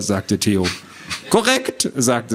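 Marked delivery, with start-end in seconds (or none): 1.3-1.9 very high, pedantic little voice; squawky know-it-all. Acted. Little man approves the children's guess, squawky. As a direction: confirm it like a fussy gnome. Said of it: "eine quäkende Stimme".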